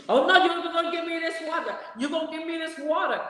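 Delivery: high shouted demand; high, pushy mock yell; shrill-voiced shopper demanding the water, shouting; mock shouting woman.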